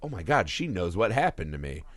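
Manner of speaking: low, soft inner voice; hushed realisation. Retold or imitated. Wife realising the guests know, acted as low hushed dread. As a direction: imitate a horrified private thought, under the breath.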